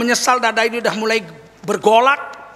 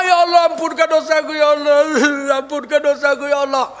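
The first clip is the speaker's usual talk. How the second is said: acted weeping supplication; crying repentant prayer; 5 semitones higher than usual. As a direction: wail for forgiveness, sobbing between phrases. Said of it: "menyesal dia".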